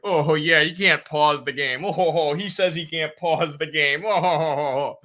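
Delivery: imitating parents making fun of their kid; mocking. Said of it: "making fun of me".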